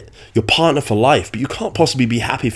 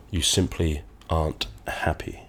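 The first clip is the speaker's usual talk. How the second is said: whisper; 2 semitones lower, 9 dB quieter than usual. Acted. Dramatic analogy, hushed.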